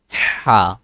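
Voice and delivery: breathy ha from the middle of the throat; ha pushed from the middle of the throat; throaty ha. Shown as a demonstration. Teacher demonstrating the middle-throat ha. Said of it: "Say that again. Ha and".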